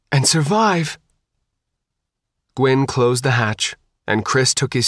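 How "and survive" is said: high, mildly tearful plea; a touch of tears in a last wish. A narrator's reading. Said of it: "tears streaming down her face".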